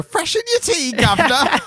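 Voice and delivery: very high, put-on falsetto doll voice; tea-serving doll character. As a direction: squeal it like a caricature British tea lady. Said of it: "someone would come over and go".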